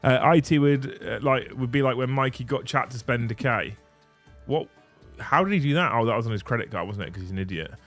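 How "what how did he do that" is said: ordinary talk, nothing marked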